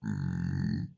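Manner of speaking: low, muffled burp; a stifled burp swallowed back in the mouth; a real burp, half held in; wine burp. Real, not acted. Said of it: "That was a wine burp".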